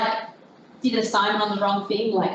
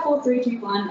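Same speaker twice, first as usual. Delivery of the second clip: usual speaking voice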